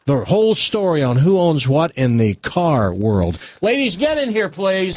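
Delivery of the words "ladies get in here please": high-pitched showman's summons; calling out. Pretend. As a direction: call them in, pitched well up. Calls the women into studio.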